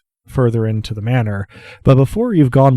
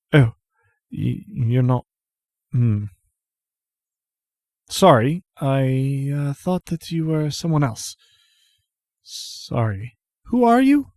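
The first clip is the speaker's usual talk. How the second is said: hesitant apology; 2 semitones higher, 3 dB quieter, slower than usual. Acted. Dishevelled woman to a stranger at the door, hesitant. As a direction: falter through an awkward apology.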